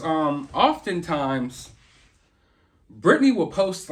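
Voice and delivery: usual speaking voice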